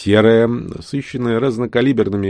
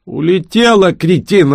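high, loud gleeful jeer; gleeful taunt; 10 semitones higher, 5 dB louder, slower than usual. Acted. Piv gloats at the killer that the crystal is gone, gleeful high taunt. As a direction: crow it out with spiteful joy. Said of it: "радостно сообщил Пив".

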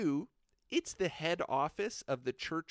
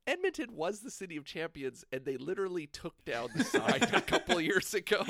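normal talking voice

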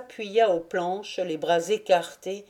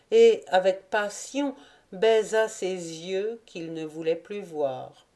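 ordinary delivery

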